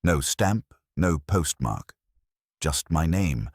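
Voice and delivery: ordinary delivery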